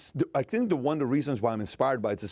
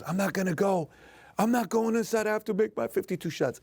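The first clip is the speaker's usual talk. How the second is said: much higher, imitating a tearful kid; crying child refusing; 8 semitones higher than usual. Dad imitating his crying son who refuses to quit, high tearful voice. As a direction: play the stubborn sick son, near tears.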